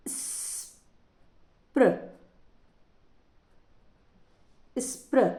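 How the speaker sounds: स्प्र drawn out slowly, barely voiced, then said again clearly; three-letter blend. Shown as a demonstration. Teacher sounding out स्प्र for young pupils. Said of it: "तीनों letters एक साथ बोले जाते हैं".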